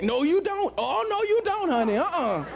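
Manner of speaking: high, sassy woman's voice refusing him; sassy scorned woman. Acted. Wronged wife scolds her husband, high and sassy.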